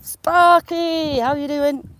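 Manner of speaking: high, loud hello, name stretched out; excited greeting. Real, not acted. Vlogger hails a returning viewer on the stream, excited greeting. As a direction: call out happily to a returning friend.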